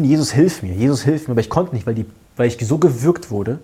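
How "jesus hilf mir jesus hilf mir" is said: retold cry for help. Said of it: "Und ich wollte rufen".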